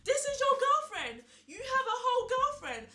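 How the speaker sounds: high, loud shriek of disbelief; imitating a friend's furious outburst; outraged yelling. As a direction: yell it out, scandalised.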